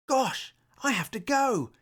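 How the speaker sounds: high, sudden alarmed realisation; startled gasp. Acted. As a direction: gasp it as you notice the time. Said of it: "he gasped".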